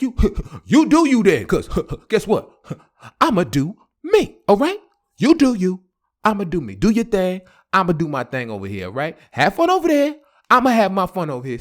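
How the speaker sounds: mock-indignant, firing each line back; defiant comeback. Man answering his girlfriend's breakup line, acted defiant.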